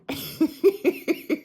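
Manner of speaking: short airy high laugh; breathy laugh. Real, not acted. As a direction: laugh out at your own line. Laughs at own joke.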